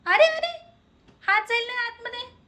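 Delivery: sudden high alarmed अरे, अरे. Real, not acted. Baby reaches into drawer. (घाबरून उंच आवाजात थांबवणे)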